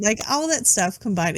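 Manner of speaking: ordinary talk, nothing marked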